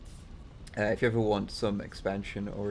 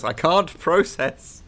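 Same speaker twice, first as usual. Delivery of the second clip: higher, louder, laughing overwhelm; flustered and excited, louder; 7 semitones higher, 11 dB louder than usual. Real, not acted. Overwhelmed by donations and eggs.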